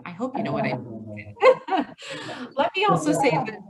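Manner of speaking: laughing it off with a chuckle; light embarrassed laugh. Real, not acted. Speaker laughing at her Michigan word to the audience, amused. Joking about Michigan slang.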